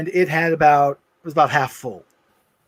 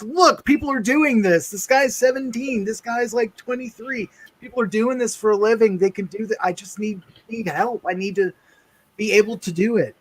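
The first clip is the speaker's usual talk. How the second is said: high-pitched, insistent pleading; imitating your younger self arguing earnestly, voice raised in pitch; retold youthful plea; 6 semitones higher than usual. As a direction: plead your case like an eager teenager, pitched up. Retold plea to disbelieving father.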